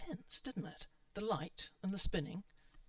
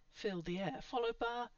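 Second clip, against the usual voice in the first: ordinary delivery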